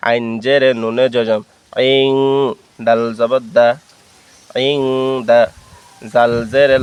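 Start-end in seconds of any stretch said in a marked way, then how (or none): 1.9-2.1 "ইং" held as a long nasal hum; drawn-out nasal ending on "ইং"; nasal ghunnah. Shown as a demonstration. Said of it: "গুন্নার সহিত ইকফা করে পড়ব". 4.7-4.8 "ইং" with a long hummed nasal ending; stretched nasal ং in "ইং"; nasal ghunnah. Shown as a demonstration. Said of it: "অন্য সুকার উচ্চারণ করব".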